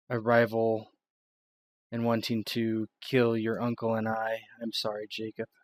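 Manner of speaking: loud, earnest Miranda; apologetic at the end; serious explanation. Acted. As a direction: lay it out gravely.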